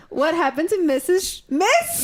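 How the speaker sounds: rising high on Miss; squeaky questioning pitch. Acted. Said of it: "cueing you with the hand signal".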